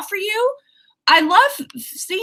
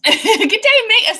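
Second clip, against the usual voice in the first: higher mock Aussie greeting, loud; playful Aussie hello, pitched up; Australian accent; 10 semitones higher, 4 dB louder, much slower than usual. Shown as a demonstration. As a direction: have another go at the Australian accent. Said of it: "so that's something Australians say".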